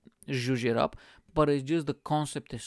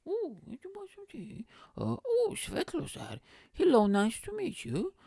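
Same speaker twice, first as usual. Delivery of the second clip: high, swooping royal greeting; mock-regal falsetto greeting; Queen impression. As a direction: put on the Queen's high-pitched hello. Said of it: "I need to imitate the Queen now".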